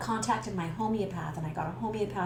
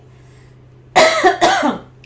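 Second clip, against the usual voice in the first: loud high choking cough; panicked cough; 17 dB louder than usual. Retold or imitated.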